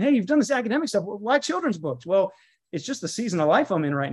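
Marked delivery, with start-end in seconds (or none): 0.0-2.0 quizzical critic. Retold or imitated. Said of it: "a lot of people are saying".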